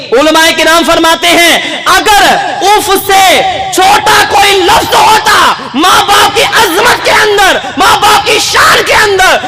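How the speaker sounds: impassioned sermon shout; full-force preaching. Acted. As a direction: proclaim it to the whole hall.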